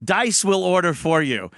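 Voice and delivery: ordinary talk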